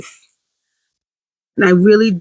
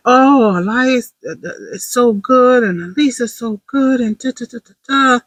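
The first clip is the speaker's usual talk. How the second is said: higher-pitched, sing-song mock praise; gushing school praise; 5 semitones higher than usual. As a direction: lightly mimic someone gushing over the kids. Acting out praise at school open house.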